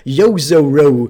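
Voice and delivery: YOZORO slow, each vowel a rounded "oh" glide; bumpy English pronunciation. Shown as a demonstration. Streamer imitates English people saying it, bumpy. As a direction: drag out "Yozoro" in bumpy syllables. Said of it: "make it more bumpy".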